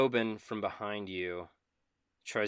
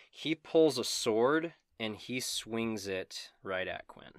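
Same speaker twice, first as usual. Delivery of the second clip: ordinary talk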